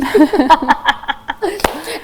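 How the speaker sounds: loud, high burst of laughter; hearty laugh. Real, not acted. Host joking with guest about breakfast, hearty laugh. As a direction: burst out laughing at your own joke.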